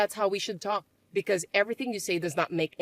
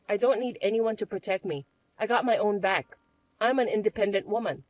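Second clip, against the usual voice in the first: ordinary talk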